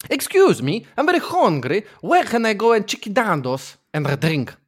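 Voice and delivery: swooping, badly faked Scouse; attempted Liverpool accent. Contestant performs third accent for judges, attempted Scouse. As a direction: overdo an unconvincing Liverpool accent.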